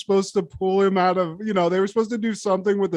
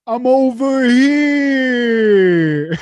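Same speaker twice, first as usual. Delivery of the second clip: higher, drawn-out holler; mock Italian guy; 5 semitones higher, 5 dB louder, much slower than usual. Co-host mimics guys on the staircase, loud mock holler. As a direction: call out like a sketch wiseguy.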